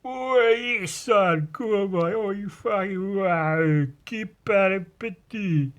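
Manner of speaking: high, drawn-out mock lad voice; mock the lads putting it on to sound big; put-on lad cadence. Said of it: "a put on thing that you do on purpose".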